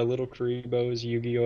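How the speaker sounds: ordinary talk, nothing marked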